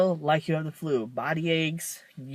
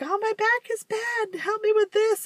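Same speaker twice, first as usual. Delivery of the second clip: put-on much higher, whiny old mom complaining; high mock mom voice. To her daughter, mom whines about her back. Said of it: "my mom's all like".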